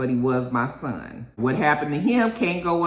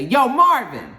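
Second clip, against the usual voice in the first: high, loud acted holler; imitated shout to get attention; calling out; 9 semitones higher, 7 dB louder, slower than usual. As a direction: hail someone by name, raised voice. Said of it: "you hear somebody say".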